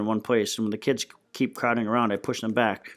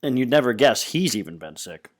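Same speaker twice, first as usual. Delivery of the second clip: ordinary delivery, nothing marked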